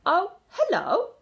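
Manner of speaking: high, loud, slow breezy hello; cool and casual. A narrator's reading. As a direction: play it calm and innocent. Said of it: "the coolness of her voice".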